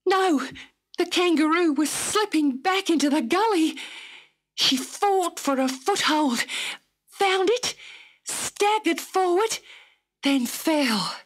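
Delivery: high, tense storytelling; suspenseful narration. A narrator's reading. Narrator telling the leap over the chasm, tense and high. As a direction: narrate each moment on the edge of the seat.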